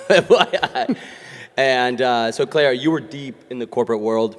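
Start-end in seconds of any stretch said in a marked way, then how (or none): 0.1-1.0 laughing. Real, not acted. Host laughing about mom.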